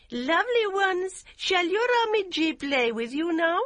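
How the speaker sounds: put-on high, bright sing-song to children; gaily. A narrator's reading. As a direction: coax the boys playfully up high. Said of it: "Yum said gaily".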